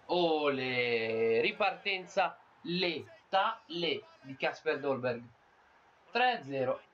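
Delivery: slow, drawn-out commentator call, then shouting; breathless italian goal commentary. Pretend. Goal commentary after third goal.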